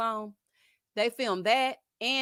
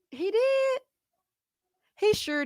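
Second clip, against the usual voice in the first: excited; 12 semitones higher, 5 dB louder, slower than usual. Real, not acted. Emphatic agreement with chat.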